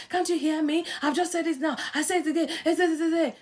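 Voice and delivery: acted impatient yelling, high-pitched; exasperated outburst. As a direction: protest loudly that nobody listens. Acts out herself losing patience, yelling.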